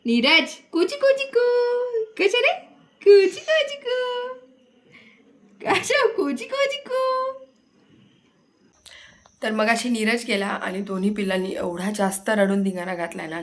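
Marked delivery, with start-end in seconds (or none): 0.7-7.3 high, slow sing-song cooing; high playful baby talk to soothe crying infants. Acted. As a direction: coo at the babies to calm them, drawn out. Mother cooing to crying babies.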